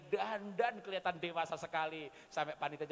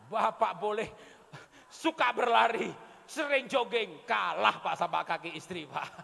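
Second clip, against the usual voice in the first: nada tinggi dan keras; seruan lantang; 7 dB louder than usual. Real, not acted. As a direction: lantangkan ke para bapak. Menantang bapak-bapak dengan lantang. (seru keras kepada bapak-bapak)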